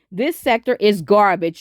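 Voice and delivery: ordinary delivery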